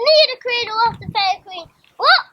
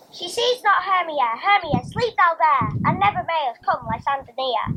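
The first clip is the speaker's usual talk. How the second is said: normal talking voice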